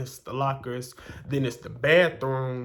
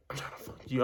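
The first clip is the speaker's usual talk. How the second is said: soft whisper, no voice; whispered come-on; 7 dB quieter, faster than usual. Retold or imitated. Boy whispers his come-on to the narrator on the stairs. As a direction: breathe it secretly so nobody hears.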